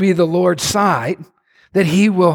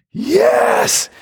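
exultant yes. Real, not acted. Preacher exults.

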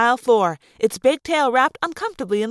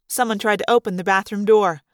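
usual speaking voice

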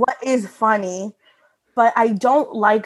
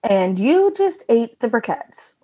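sitcom punchline reading. Shown as a demonstration. Giving co-hosts a sitcom-style reading, slow and pointed. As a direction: draw out "you", stretch the line for comic effect. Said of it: "give you a reading on it".

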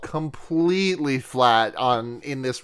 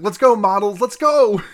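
higher, louder cheering chant; mock rally cry; 6 semitones higher, 5 dB louder than usual. A character rallying the models, a loud, high mock cheer. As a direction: pump up the crowd, hammed up. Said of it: "it's very much that for this".

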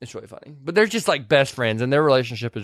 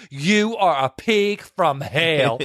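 very slow, drawn-out mock venom; retold insult; much slower than usual. Retelling Weezer insulting Clary, slow mock venom. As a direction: stretch every word of the insult.

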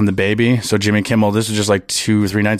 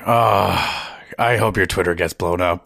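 snide wish; 5 semitones lower, 4 dB quieter than usual. Retold or imitated. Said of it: "I really was like".

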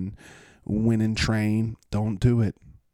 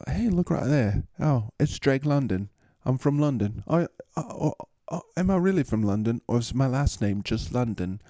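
put-on, higher London accent; 4 semitones higher than usual. Drake London asking about his own name, mock London accent. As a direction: play a perky Londoner with an English accent. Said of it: "I like to say that in that accent".

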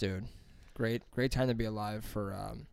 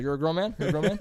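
questioning gatekeeper; 6 semitones higher, 5 dB louder, faster than usual. Retold or imitated. Acting doorman checking for grown men.

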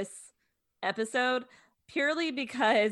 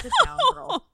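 much higher and louder mock put-down; sassy dismissal; 8 dB louder than usual. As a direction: shoot her down with a sassy squeal. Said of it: "And I'm like".

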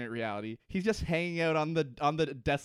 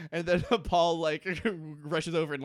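usual speaking voice

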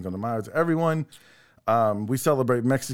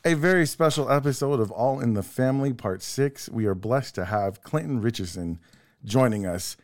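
ordinary talk

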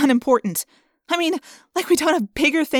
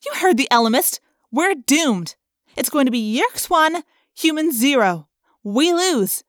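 raised, heated argument; defiant retort; 6 semitones higher, 4 dB louder than usual. A narrator's reading. Rachel firing back at Jake that all is lost, defiant. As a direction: argue back with reckless, fed-up force. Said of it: "I shot back".

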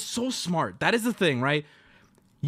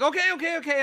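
flustered; 8 semitones higher, faster than usual. Retold or imitated. Answering pounding on door.

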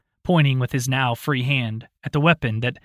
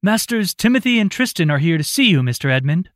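higher, louder woman's voice through a door; confident young maid; 6 semitones higher, 5 dB louder, faster than usual. A narrator's reading. Maid announcing guests to her master, confident higher voice. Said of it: "A young woman's voice spoke confidently".

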